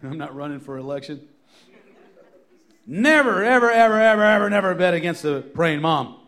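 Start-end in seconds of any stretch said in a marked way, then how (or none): 2.9-6.2 loud, higher, driving repetition; preached at full force. Real, not acted. Preacher repeats the title to the moms at full force. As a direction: build it like a revival call. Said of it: "As a theme".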